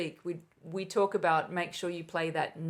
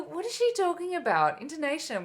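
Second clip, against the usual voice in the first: much higher, put-on puzzled voice; acted-out bafflement; 9 semitones higher, 3 dB louder than usual. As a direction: mimic young players baffled by their conductor. Students' puzzled reaction, acted out.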